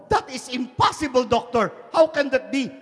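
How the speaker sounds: loud, high incredulous protest; shocked disbelief. Acted. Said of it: "he was surprised".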